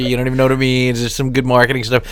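ordinary talk

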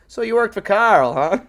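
high, loud smug Homer-style jab; imitating Homer. Homer teasing Mary Poppins in a high, loud voice. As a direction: needle her like a smug Homer. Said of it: "He goes".

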